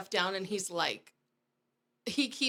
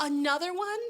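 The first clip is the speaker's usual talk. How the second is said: higher, louder disbelieving question; mock incredulity; 7 semitones higher, 5 dB louder than usual. Officer hearing about yet another husband, incredulous. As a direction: act out an astonished officer.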